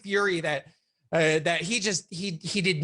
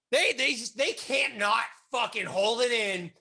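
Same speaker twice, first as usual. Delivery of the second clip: ordinary talk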